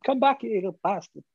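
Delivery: high, fast mock shout; hammed-up chase yell. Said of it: "me jumping around after him".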